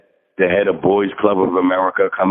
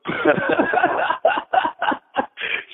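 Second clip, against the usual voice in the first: high, breathless laughing fit; real laugh; 4 dB quieter than usual. Real, not acted. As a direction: let a long string of high laughs tumble out. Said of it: "So I laugh at that".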